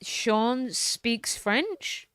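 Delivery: very slow, puzzled rising question; drawn-out, confused query. Real, not acted. Streamer puzzles over the app's phrase, slow and rising.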